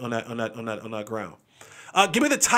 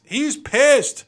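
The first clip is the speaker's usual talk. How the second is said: ordinary delivery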